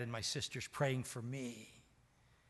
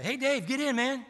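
high, loud call from a car window; friend calling out; 11 semitones higher, 9 dB louder than usual. Retold or imitated. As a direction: hail him cheerily, much higher.

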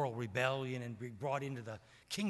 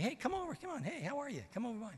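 much higher, soft inviting call; beckoning woman; 11 semitones higher, 3 dB quieter, faster than usual. Retold or imitated. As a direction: lure him over sweetly, pitched up.

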